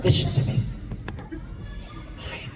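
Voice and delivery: put-on father confiding in his twelve-year-old son, hushed. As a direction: keep it down so mother won't hear. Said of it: "He looks at me and goes".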